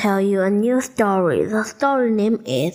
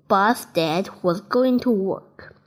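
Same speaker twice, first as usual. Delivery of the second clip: plain reading voice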